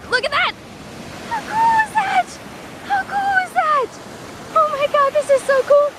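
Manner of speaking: very high, thrilled exclamations; amazed squeal. Real, not acted. Showing viewers the sights, squealing high. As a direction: squeal with delight at the view.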